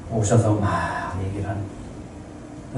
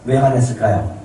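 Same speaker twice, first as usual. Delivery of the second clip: ordinary delivery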